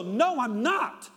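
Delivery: playing his wife at the peak of the fight, yelling; mock shouted denial. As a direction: shout it as the fight boils over. Quarrel peaks, wife shouts.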